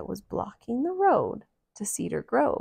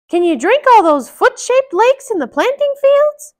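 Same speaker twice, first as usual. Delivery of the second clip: Mickey voice; 14 dB louder than usual. Acted. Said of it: "asked Mickey".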